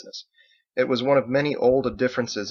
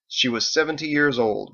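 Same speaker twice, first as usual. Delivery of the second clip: usual speaking voice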